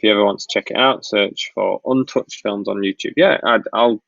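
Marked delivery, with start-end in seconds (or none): none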